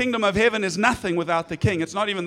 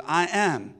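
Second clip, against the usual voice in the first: calm assurance; 6 semitones lower than usual. Retold or imitated. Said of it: "he said".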